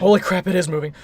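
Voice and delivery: loud shocked exclamation; startled outburst. Acted. Passenger seeing the box move, loud startled outburst. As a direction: blurt it out in sudden alarm.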